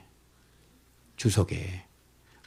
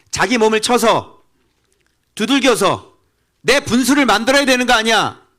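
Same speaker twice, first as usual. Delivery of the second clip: loud, high fiery sermon; a preacher shouting at the congregation; preaching at full force; 12 semitones higher, 9 dB louder than usual. Acted. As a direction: thunder it from the pulpit, high and loud.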